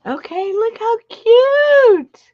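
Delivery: high, slow, gushing squeal; excited squeal. Real, not acted. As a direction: coo over something adorable. Showing off a cute craft.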